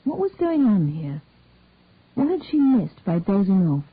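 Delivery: plain reading voice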